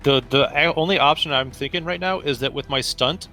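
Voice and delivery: usual speaking voice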